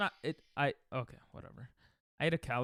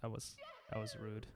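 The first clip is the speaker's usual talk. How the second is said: much softer, lower sheepish apology; 6 semitones lower, 15 dB quieter, faster than usual. Real, not acted. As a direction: murmur an embarrassed sorry. Apologising softly.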